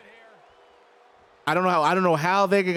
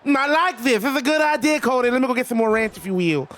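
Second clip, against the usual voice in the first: higher-pitched wrestling-legend impression, indignant then pleased; Dusty Rhodes; 5 semitones higher than usual. Wrestler scolds Cody over ranch on pizza, in impression. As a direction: play an old wrestler fussing over his food. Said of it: "I will do a Dusty Rhodes voice".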